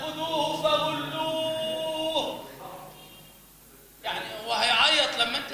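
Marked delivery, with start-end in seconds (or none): 0.0-2.4 high, slow, theatrical recitation of خذوه فغلوه; overacted tearful reciter; mock dramatic wailing. Shown as a demonstration. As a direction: ham up the verse of punishment, weeping. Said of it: "ولا التشاجر مع الأحرف".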